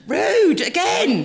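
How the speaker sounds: high, loud mock indignation; playful, high-pitched telling-off; mock outrage. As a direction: cry out as if scandalised.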